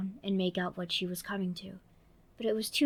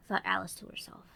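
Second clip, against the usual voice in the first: plain reading voice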